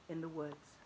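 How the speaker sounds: plain reading voice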